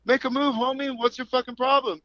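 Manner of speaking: quoting himself squaring up to the guy; retold challenge. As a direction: throw down the challenge with swagger. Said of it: "I was all".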